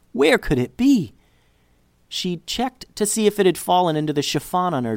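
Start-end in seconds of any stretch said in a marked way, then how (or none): 0.1-1.1 panicked. A narrator's reading. Sudden panic over lost necklace.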